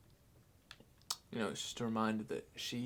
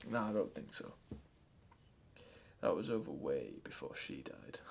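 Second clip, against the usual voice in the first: bitter resignation; 10 semitones lower, 7 dB quieter than usual. Acted. Grandchild admitting the family was over, low resignation. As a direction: voice dropped low and soft, deflated. Deflated after the outburst.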